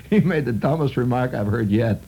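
ordinary talk

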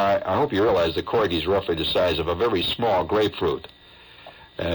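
ordinary delivery

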